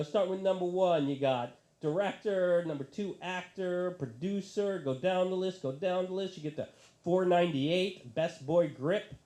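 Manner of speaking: usual speaking voice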